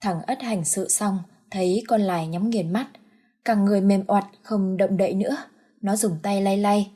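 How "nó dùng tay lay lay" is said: usual speaking voice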